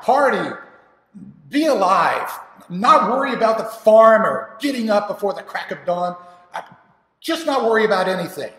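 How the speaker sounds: high, loud, exasperated cry; escalating, full-voiced rebellion; rebellious son's outburst. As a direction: shout for your freedom at your father. Son shouts for freedom.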